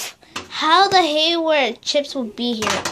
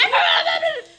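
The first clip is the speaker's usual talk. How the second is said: insistent shout; 12 semitones higher than usual. Pretend. Insisting after repeats.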